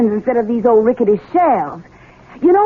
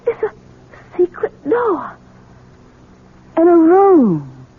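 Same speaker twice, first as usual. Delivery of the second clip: astonished; 5 semitones higher, slower than usual. Acted. Discovering hidden room.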